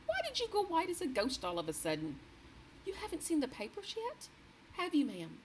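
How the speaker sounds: two anxious women talking over news; dialogue voices. A narrator's reading. Maid and queen anxiously discussing the papers.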